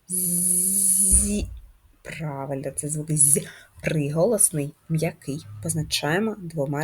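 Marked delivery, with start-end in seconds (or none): 0.1-1.5 long, sustained buzzing З sliding into a short І in "Зі"; softer, stretched "Зззі" to isolate the sound; drawn-out first sound. Shown as a demonstration. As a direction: hold the З of "Зі" like a long buzz. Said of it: "Який перший звук?".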